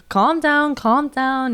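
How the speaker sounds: imitating someone trying to settle people down; mild defusing plea. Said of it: "he's trying to diffuse the situation".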